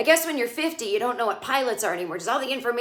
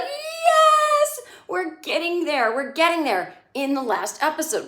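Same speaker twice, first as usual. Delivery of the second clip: excited cheer; 6 semitones higher, slower than usual. Retold or imitated. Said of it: "And I was like".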